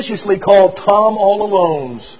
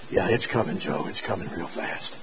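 much lower and softer, half whispered; hushed reassurance; 11 semitones lower, 16 dB quieter than usual. A narrator's reading. Doctor reassures dying Joe the light is coming, hushed. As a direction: soothe him in a near whisper.

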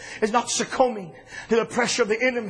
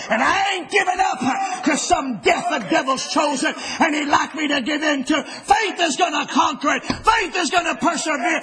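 driving, raised preaching cadence; fiery preaching; 5 semitones higher than usual. Acted. Preacher declaring Jesus' destiny to the church, fiery cadence.

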